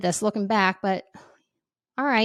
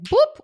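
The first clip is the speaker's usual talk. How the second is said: louder boop sound; mock boop; 8 dB louder than usual. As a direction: pop out a boop. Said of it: "my brain just, like".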